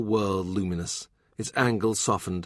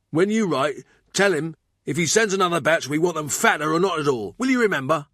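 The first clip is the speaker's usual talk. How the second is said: higher, louder busy cook giving orders; a fussing cook laying down the law, pitched up; Thurston the bustling cook; 7 semitones higher, 4 dB louder than usual. Acted.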